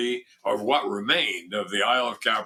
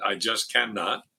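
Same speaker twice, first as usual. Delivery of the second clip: ordinary talk, nothing marked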